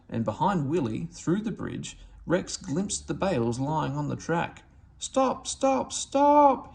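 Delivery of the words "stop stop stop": high, loud whistling cry of stop; whistled stop. A narrator's reading. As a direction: shriek it like a steam whistle, rising. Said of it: "he whistled".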